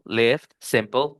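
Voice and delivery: "lift, simple" slowly, with a short lax i; slow demo. Shown as a demonstration. Teacher slowly modelling short i words. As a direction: stretch the words out to show the i.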